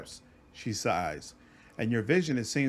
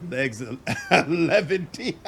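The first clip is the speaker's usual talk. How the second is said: laughing; 2 semitones higher, 3 dB louder, slower than usual. Acted. Laughs mid line.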